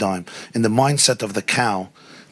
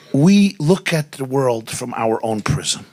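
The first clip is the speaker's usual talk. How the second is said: usual speaking voice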